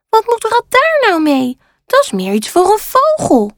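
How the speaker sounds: high-pitched, puzzled scoff from a panda; high storybook panda, unimpressed; dismissive panda. A narrator's reading. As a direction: wave the gift away in a high voice. Maas dismisses the crow's find.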